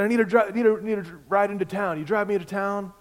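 put-on high, loud, loose drunk voice; drunk driver. Drunk driver in a ditch asking the pastor for a ride, slurred. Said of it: "He is completely drunk".

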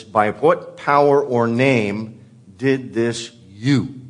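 slow, deliberate, every word spaced out; slow Greek word order. Shown as a demonstration. Preacher reads the Greek order slowly to the congregation, deliberate. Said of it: "The Greek puts it like this".

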